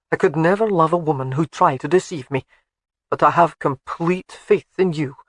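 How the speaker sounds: light Scottish burr, fond and teasing; a Highland laird teasing his bride; Scottish husband Alec. Acted. Alec baits Jamie.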